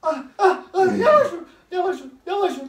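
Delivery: sharp pained gasps; hissing in pain. Real, not acted. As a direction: cry out with each hit. Being hit on the feet.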